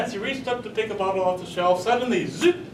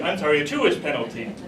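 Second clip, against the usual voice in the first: usual speaking voice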